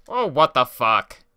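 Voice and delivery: loud, high frustrated shout; angry outburst. Real, not acted. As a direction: yell at the game in disbelief. Game glitch frustration.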